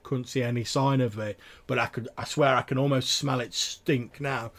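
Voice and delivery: country yokel building up his monster story; eager tavern tale-teller. Acted. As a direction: play the trapper relishing a new audience.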